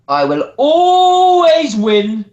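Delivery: loud, high, long-held ALWAYS; slow, swelling victory cry; showman's boast. Acted. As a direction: stretch ALWAYS out like a wrestling catchphrase. Wrestler's closing promo flourish.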